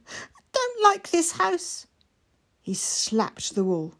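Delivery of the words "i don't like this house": high, slow and wavering; trembling little boy. Acted. Mikey scared, reaching for the prints, trembling. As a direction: let the small voice quiver. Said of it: "His voice trembled".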